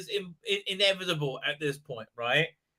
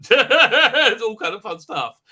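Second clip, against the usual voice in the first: laughing; 4 semitones higher, 8 dB louder, slower than usual. Real, not acted. Laughing while reading chat.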